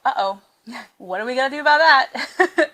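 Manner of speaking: giggly mock panic; laughing uh-oh. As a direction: laugh through a worried uh-oh. Voicing the two women.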